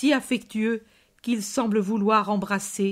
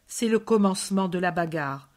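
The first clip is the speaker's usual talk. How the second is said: ordinary delivery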